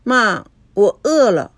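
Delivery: put-on slow, pouty child's complaint; aggrieved pout. A narrator's reading. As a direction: whine a little, feeling wronged. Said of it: "委屈地嘟起了小嘴". (委屈地撅着嘴说)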